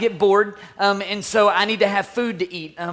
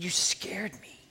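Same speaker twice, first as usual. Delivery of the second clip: soft, whispery confession; hushed relief; 3 semitones lower, 13 dB quieter, slower than usual. Retold or imitated. Dad holding the son who scared him, whispering. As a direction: whisper it to the child.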